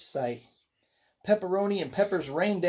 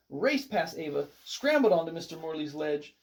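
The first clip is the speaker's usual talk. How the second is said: usual speaking voice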